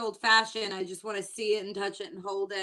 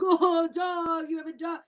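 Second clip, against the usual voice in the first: mock pickup line; 7 semitones higher, 3 dB louder than usual. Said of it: "They were like".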